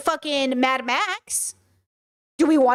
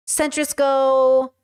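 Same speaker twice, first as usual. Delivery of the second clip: usual speaking voice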